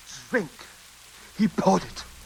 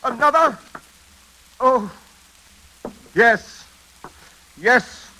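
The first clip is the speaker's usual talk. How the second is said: much higher, louder, stunned; startled dismay; 9 semitones higher, 4 dB louder, slower than usual. Acted. Master to butler, hearing of another death, high and startled. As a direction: react as the news hits you.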